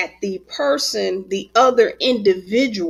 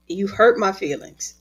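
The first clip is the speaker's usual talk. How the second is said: normal talking voice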